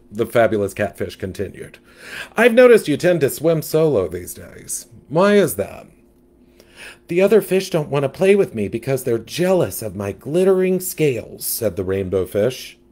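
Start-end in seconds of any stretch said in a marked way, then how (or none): 2.3-5.8 louder, sassy gossip; sassy catfish. Acted. 7.1-11.5 much higher, timid little fish; small hesitant fish, pitched up; young fish voice. Acted.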